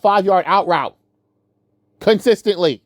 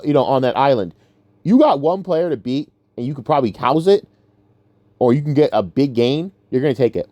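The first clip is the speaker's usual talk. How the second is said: usual speaking voice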